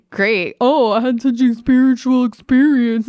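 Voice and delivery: loud, high, gushing parody; mocking dreamy friend. Mocking her friend's spiritual trip talk, loud and gushing. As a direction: overdo the blissed-out tone. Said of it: "you can't just say".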